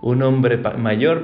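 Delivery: ordinary talk, nothing marked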